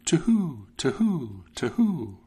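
high hooting owl calls, falling each time; owl hoots. A narrator's reading. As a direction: hoot like a night owl. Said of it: "each time it cried".

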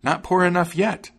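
high-pitched bird taunt; high, vengeful little bird; taunting sparrow. A narrator's reading. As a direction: pipe it high, rising at the end. Sparrow taunting the ruined wagoner.

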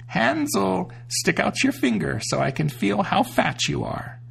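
high witch voice calling out; a witch calling into the pen. A narrator's reading. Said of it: "cried out".

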